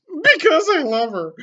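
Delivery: loud, high shriek. Acted. Draco shrieks his love to the teachers. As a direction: shriek it out in despair. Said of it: "Draco shrieked".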